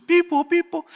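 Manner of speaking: high, loud rising siren; a laughing, hammed-up siren; siren imitation. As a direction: wail it like a police siren coming closer. Siren getting closer in story.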